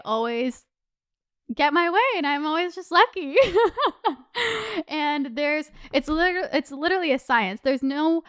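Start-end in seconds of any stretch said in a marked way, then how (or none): 3.4-4.8 high burst of laughter. Real, not acted. Laughs at own luck claim.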